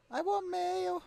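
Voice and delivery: high, loud squeak; character voice. Acted. Streamer voices Moogle asking for mail. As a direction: squeak it like a needy critter.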